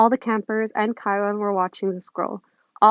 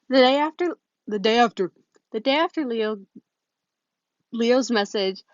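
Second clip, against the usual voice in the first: ordinary delivery, nothing marked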